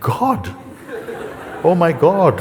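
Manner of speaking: incredulous question rising into loud awe; acted astonishment. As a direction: echo it in mock disbelief, then exclaim. Man astonished at the answer.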